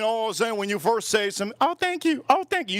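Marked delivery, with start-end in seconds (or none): 1.6-2.8 high timid nerd thanks; nerdy voice. Retold or imitated. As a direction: squeak it out like a shy nerd. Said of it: "You sound like a nerd".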